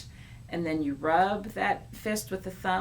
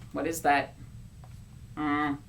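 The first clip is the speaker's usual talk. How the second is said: ordinary delivery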